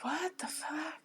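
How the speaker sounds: breathy shocked mutter; startled. Retold or imitated. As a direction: react like you just heard a ghost baby. Guy hearing baby scream in graveyard.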